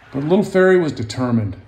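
lively picture-book narration; storybook read-aloud voice; reading to children. A narrator's reading. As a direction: tell it gently to young listeners.

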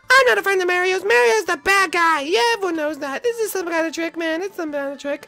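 high cartoon voice, distrustful; suspicious Toshi. Toshi refusing to believe, high voice. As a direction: brush it off as a trick.

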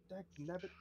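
a lightly voiced grumbling ghost; a touch of an annoyed ghost. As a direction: mutter it like a cranky spirit. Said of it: "And you hear".

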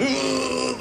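a high strained choking grunt; imitated choking groan. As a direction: mimic a man cutting his throat, gagging. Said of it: "going ... like this".

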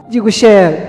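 usual speaking voice

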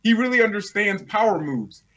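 usual speaking voice

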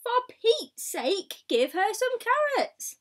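high, loud mock-exasperated demand; exasperated plea. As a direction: plead with the neighbours in mock exasperation. Exasperated aside to the neighbours.

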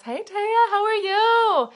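high, loud, stretched-out hello; excited greeting. Real, not acted. Streamer greeting a viewer in chat, excited and high. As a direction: squeal a delighted hi to a viewer.

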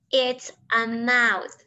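slow, clear answer for learners; slow model answer. Shown as a demonstration. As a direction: lay the words out slowly.

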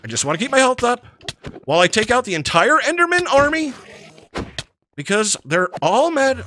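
ordinary talk, nothing marked

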